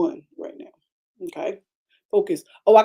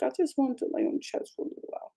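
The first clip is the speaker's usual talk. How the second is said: quietly whispered, a little quick; soft pleading whisper; 6 semitones higher, 9 dB quieter, faster than usual. Retold or imitated. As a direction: whisper it sweetly to your husband. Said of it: "I was like".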